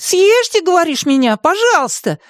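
very high, squeaky mimicking; fuming small creature mocking a plea; raging mockery. A narrator's reading. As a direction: throw your friend's words back indignantly. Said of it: "продолжал бушевать Мартын".

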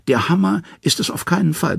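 high, pinched trickster voice; high-voiced Loki protesting; Loki, sly and scared. A narrator's reading. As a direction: play the frightened schemer Loki. Loki's voice in the story.

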